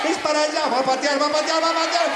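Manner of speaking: high, loud frantic es para allá; panicked warning. Acted. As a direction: yell a frantic warning at the field. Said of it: "Y Juancito". (grito agudo y desesperado de Juancito)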